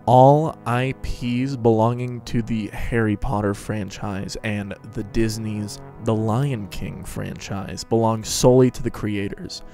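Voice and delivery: plain reading voice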